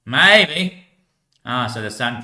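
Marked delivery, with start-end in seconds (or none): 0.1-0.8 high, loud, drawn-out reply; lifted, stretched reply; answering voice. Acted. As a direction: sing out the answer teasingly. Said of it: "he actually answered".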